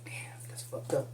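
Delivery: quiet aside to a friend; whispered remark. Retold or imitated. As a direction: mutter it under your breath at the table. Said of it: "I'm like".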